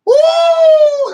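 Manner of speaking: high shouted woo; hype woo. Real, not acted. Reacting to the movie line with a hyped shout. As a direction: holler it.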